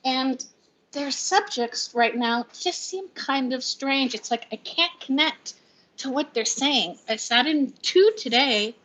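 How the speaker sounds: ordinary talk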